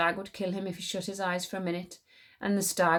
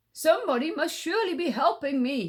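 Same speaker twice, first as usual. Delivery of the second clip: higher, louder puzzled tiger; 8 semitones higher, 4 dB louder than usual. Acted. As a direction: wonder aloud as the tiger. Tiger voice in story.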